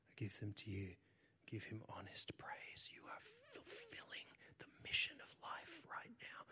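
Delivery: soft unvoiced whisper of praise; whispered prayer. Real, not acted. Pastor whispering praise over the congregation, hushed. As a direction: breathe the words like a prayer.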